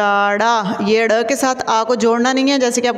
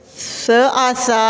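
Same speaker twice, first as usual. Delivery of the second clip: ordinary talk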